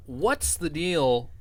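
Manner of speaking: put-on slow, drawn-out stand-up comic British accent-free setup: "what's the deal?". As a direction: stretch "what's the deal" slowly like an observational comic.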